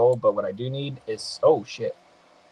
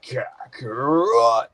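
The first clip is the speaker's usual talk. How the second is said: hammed-up anime brute, very drawn out; Broly; much slower than usual. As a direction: stretch the name out slowly in a mock anime roar. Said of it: "Broly impression".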